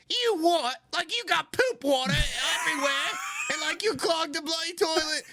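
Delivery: high-pitched, incredulous British scolding; British woman impression. Friend imitates British woman aghast at clogged toilet, shrill British accent. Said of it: "the British woman's just like".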